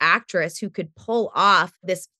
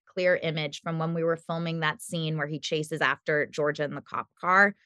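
ordinary talk, nothing marked

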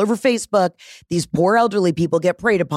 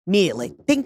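normal talking voice

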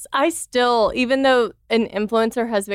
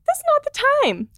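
much higher, incredulous squeak; mock indignant retort. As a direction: play up the outrage at the comment. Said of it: "And I'm like".

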